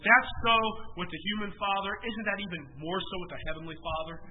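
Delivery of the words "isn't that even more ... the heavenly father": ordinary delivery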